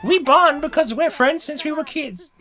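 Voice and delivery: high, lightly voiced sincere friend. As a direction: say it like a sweet old friend. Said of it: "It's like".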